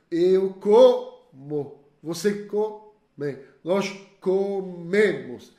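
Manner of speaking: very slow, spelled-out conjugation; slow model. Shown as a demonstration. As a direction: model each form slowly. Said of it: "modelling conjugation". (conjugando bem devagar)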